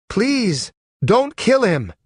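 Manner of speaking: high, urgent plea; pleading. Acted. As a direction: beg him to spare the man. Pleading for enemy's life.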